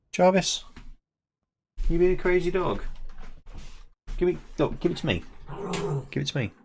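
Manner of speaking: high, playful pet talk; high doting voice asking for the toy; coaxing the dog. Real, not acted. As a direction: coax the dog into giving the toy.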